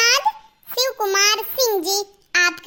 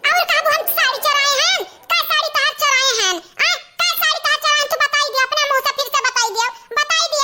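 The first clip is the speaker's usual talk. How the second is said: higher, rapid-fire mock quarrel; indignant challenge; 6 semitones higher, much faster than usual. Accused woman demanding proof of sari theft, mildly indignant. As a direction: demand an answer, quick and high.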